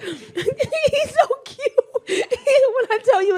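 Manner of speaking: very high, slow gushing. Real, not acted. Gushing over her grandbaby, very high and slow. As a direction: coo it out slowly.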